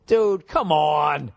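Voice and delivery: slow drawn-out dude come on; exasperated. Real, not acted. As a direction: drag it out in disbelief. Reacting to creepy math.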